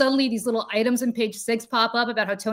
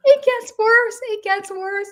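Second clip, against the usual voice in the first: excited; 13 semitones higher, 7 dB louder, slower than usual. Real, not acted. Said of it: "ends up in the New York Post".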